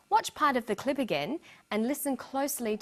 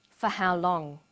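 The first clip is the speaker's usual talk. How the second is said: information question dropping through "long"; falling tone. Shown as a demonstration. Teacher echoing the doctor's question, falling tone on "long". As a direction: let the pitch fall to the end on "long". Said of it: "but it has a falling tone".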